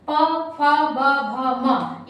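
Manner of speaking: teacher showing lip sounds; very slow letter demo. Shown as a demonstration. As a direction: sound out प फ ब भ म slowly and firmly. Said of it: "जब हम पा वर्ग को बोलते हैं".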